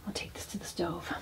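soft unvoiced whisper. Real, not acted. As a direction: whisper it so nobody wakes. Whispering at night by camp.

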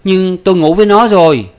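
high, loud defiant confession; snapped retort. Acted. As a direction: fling the confession back at the father. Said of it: "Đào vặt lên".

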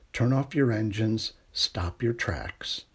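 plain reading voice

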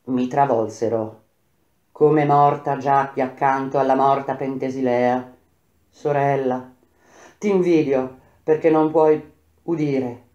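ordinary delivery, nothing marked

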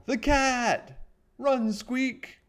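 very high, loud shout of alarm; panicked shout. A narrator's reading. Mouse warns friend the cat is real, panicked shout. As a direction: yell an urgent warning at the top of the voice.

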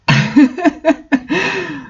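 high burst of real laughter. Real, not acted. Reader breaking down at the fanfic, laughing. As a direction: crack up at the absurd text.

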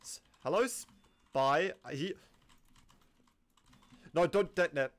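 ordinary delivery

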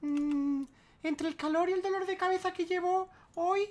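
high, whiny mock complaint. Mocking a streamer's tweet, high whiny voice. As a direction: parody a sulky streamer. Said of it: "El muy gilipollas que dice".

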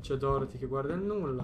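ordinary talk

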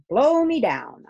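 loud, high burst of amazement; hearty exclamation. A narrator's reading. Angus to Grumble about the grown water horse, hearty exclamation. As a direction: exclaim it like a young sailor.

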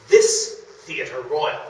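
slow, loud, drawn-out reading of the note; slow loud quoted title; quoting a map note. Retold or imitated. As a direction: read the margin note slowly and grandly, like a sign. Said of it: "he wrote".

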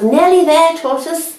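high, loud cheer; cheering yell. A narrator's reading. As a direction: yell from the crowd. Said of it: "yells of".

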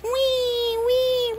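high drawn-out mock wail; fake-cry in a high squeal; pretend monkey crying. Little monkey being comforted, high pretend crying. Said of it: "Don't cry".

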